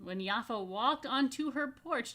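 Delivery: plain reading voice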